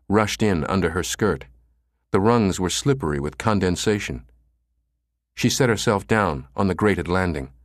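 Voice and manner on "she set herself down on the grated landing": ordinary delivery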